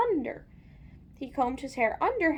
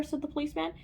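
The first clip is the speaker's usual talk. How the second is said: ordinary delivery, nothing marked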